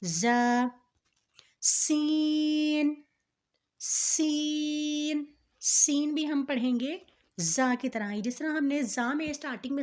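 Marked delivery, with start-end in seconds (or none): 0.0-0.7 زا with a strong buzzed z, vowel held; hard pronunciation. Shown as a demonstration. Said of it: "پڑھا ہے سختی کے ساتھ". 1.6-5.2 سین with a sharp whistled s and very long "ee"; hard whistling s. Shown as a demonstration. Teacher modelling for learners, سین whistled and drawn out. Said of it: "سیٹی کی آواز آئے گی".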